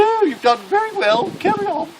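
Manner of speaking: high, loud mock-royal praise; Princess Anne. Princess praises the wounded soldier, in a high hammed-up royal voice. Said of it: "She said".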